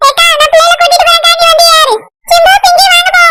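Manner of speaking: put-on high-pitched young boy voice; high boyish voice; little brother. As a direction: call the children along as a boy, pitched well up.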